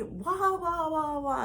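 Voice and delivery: high mocking whine, wah wah; mock whining wah. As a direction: whine it like a sulky child. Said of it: "And he was mad".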